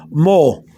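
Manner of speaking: "mo" with two blended O's; two O's pronounced. Shown as a demonstration. Teacher demonstrating the plural to learners, double O in "mo". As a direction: sound the O twice in "mo", run together. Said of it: "pronounce the two O's like this".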